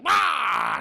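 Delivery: high, loud mock bellow; angry roar. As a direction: burst into a frustrated roar. Beast roars at refusal.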